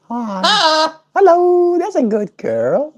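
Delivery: high cooing praise for the bird. Real, not acted. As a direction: coo a sweet hello to the bird. Greeting pet bird.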